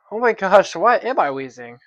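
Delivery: exasperated. Real, not acted. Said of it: "it's so annoying".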